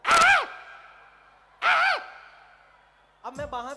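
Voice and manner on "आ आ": very high, loud crow caw. Retold or imitated. Comic voicing the irritated crow husband, loud caw. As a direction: screech it like an angry crow. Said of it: "कहता".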